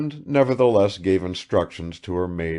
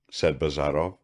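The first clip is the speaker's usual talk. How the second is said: usual speaking voice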